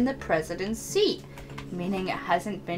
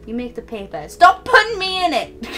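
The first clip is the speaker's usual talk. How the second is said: confrontational Connie; 8 semitones higher, 5 dB louder than usual. Acted. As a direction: lay down the law, building to a yell. Connie confronting the newspaper club.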